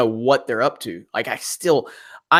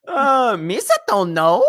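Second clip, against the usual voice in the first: slow, high goofy creature voice; drawn-out Jar Jar reply; Jar Jar impression; 6 semitones higher, slower than usual. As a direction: answer cluelessly like Jar Jar Binks. Answers as Jar Jar Binks.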